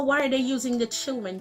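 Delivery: ordinary talk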